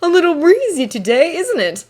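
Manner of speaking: light, high-pitched innocent remark; feigned casual taunt. A narrator's reading. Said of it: "without seeming intentional".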